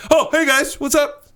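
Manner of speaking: high, loud playful greeting; goofy Jesus voice. Retold or imitated. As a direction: pop in like a cheery ghost. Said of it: "Just being like".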